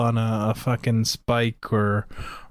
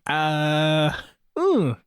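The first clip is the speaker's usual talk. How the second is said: pained wince; 3 semitones higher than usual. Retold or imitated. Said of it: "you're like".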